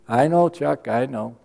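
gentle reassurance. Retold or imitated. God answers him gently.

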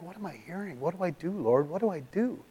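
soft, broken plea to God; hushed prayer. Retold or imitated. Alone on the couch he quietly begs the Lord, hushed. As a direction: pray under your breath, lost.

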